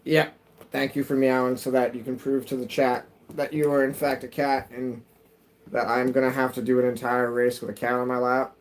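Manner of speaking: usual speaking voice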